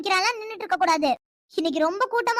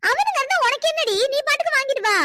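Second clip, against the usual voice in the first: scolding; 5 semitones higher than usual. Acted. Aunt scolds shy girls.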